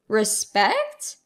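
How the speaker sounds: ordinary delivery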